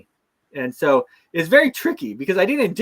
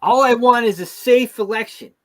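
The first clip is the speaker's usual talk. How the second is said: higher and louder, in a hammed-up sincere whine; mock earnest plea; 9 semitones higher, 7 dB louder than usual. Mocking politicians who want safe elections, in a hammed-up plea.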